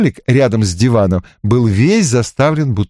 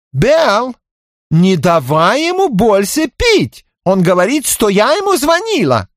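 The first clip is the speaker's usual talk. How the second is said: high slow sulky whine; offended plea; 13 semitones higher than usual. A narrator's reading. Tipsy Zorka complaining to Belinda about the detective, sulky whine. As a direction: whine to her friend, offended. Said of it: "обиженно попросила".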